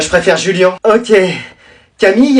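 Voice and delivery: ordinary talk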